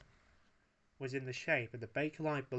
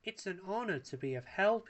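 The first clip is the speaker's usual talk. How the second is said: lightly voiced gallant Wallace, higher; Wallace's voice; 7 semitones higher than usual. A narrator's reading. Wallace gallantly answers the grateful lady. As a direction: play the modest hero. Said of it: "he said".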